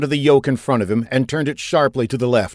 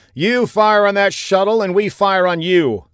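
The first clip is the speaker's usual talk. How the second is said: booming, higher and louder threat; Gon; 9 semitones higher, 5 dB louder than usual. A narrator's reading. Admiral threatening to fire back, booming over the radio. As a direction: thunder it out over the speakers. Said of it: "Gon's booming voice came resonating".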